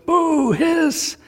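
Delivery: high mock boo and hiss at the villain; booing audience. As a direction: jeer like a hostile crowd hearing the name. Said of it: "you go".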